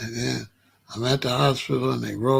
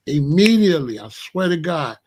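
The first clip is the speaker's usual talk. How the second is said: usual speaking voice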